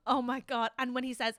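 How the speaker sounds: normal talking voice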